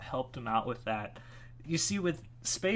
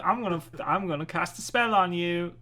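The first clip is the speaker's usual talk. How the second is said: higher-pitched mock British; British accent; 5 semitones higher, 4 dB louder than usual. Imagining Amicus casting a spell, mock British accent. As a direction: put on a playful British accent. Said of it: "What if Amicus suddenly turned British?".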